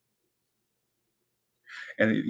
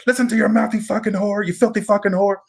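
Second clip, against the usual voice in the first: much higher, hammed-up crank-call voice; high, gleeful mock-obscene phone taunt; Serial Mom prank caller; 9 semitones higher than usual. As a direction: mimic the movie's unhinged prank caller, pitched way up. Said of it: "and she goes".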